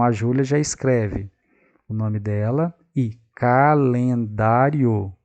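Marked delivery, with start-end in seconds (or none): none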